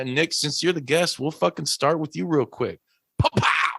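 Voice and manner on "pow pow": hype call. Acted. Host hyping the guest.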